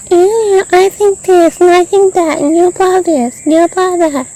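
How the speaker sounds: high, loud mocking parody of nagging housemates. Mocking the housemates who nag him, in a high, loud put-on voice. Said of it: "I hate hearing them nag".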